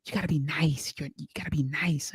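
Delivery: hushed, whispery mock complaint; very soft, breath-heavy pleading; imitated soft plea. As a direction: imitate a meek scolding in a near-whisper. Acts out emailed plea to be nicer.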